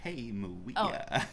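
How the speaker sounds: put-on slow childlike puppet voice; drawn-out kid puppet greeting; Baby Bear voice.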